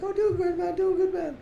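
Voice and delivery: high, sing-song imitation of a laid-back friend; a high mock "doing good, man"; mock chill reply. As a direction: toss it off in a lifted, breezy voice.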